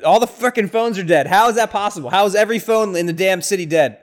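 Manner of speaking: loud, high, fed-up rant at the movie; exasperated outburst. Real, not acted. Reacting to the movie, loud frustrated rant. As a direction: yell at the absurdity of dead phones. Ranting about dead phones in movie.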